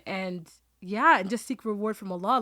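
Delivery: ordinary talk, nothing marked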